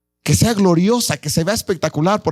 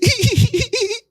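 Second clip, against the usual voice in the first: high laugh that could pass for a sob; laugh like crying. Shown as a demonstration. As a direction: show a laugh you can't tell from weeping. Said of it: "O se ríen también así".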